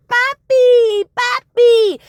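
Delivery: put-on high, loud excited kid voice; kids calling papi. Twins running to their father, high and excited. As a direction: squeal like a little girl greeting daddy.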